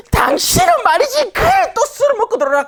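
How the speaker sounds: high-pitched, fussing mock wife; nagging wife. Wife scolding husband for coming home after a beer, high mock nagging. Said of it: "난리를 피우는 거지".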